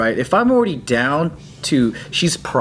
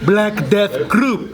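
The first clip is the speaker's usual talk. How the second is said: slow grand announcement; 6 semitones higher, much slower than usual. Pretend.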